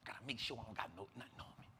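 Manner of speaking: fast hushed mutter to himself; quick low self-check under breath. Retold or imitated. Checking himself before entering.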